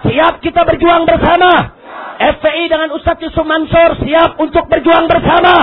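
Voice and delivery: rallying shout. Real, not acted. Preacher calls the crowd to struggle together, rallying shout. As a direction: fire up the crowd with full-force calls.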